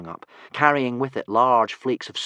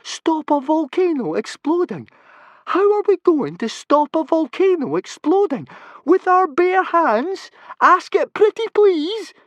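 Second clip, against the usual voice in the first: far higher, louder, rising hysteria; very high frantic boy; panicky Fishlegs; 11 dB louder than usual. Acted. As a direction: squawk in sarcastic panic. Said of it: "he said".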